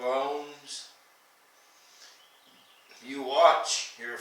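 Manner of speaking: declaimed poem, each phrase falling away; poetry recital with long pauses between phrases; poem reading. Acted. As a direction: open lines high and strong, then let them drop. Reads a poem aloud.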